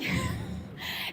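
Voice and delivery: short unvoiced high giggle; breathy high laugh. Real, not acted. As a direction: burst out laughing softly. Laughing at own story.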